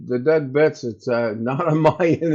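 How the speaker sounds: normal talking voice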